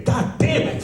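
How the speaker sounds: very high, exasperated curse; hammed-up squeal of frustration; mock frustrated cry. Man thwarted by neck day curses, high and frustrated. Acted frustration at failed plan.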